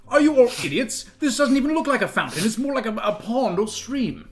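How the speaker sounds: normal talking voice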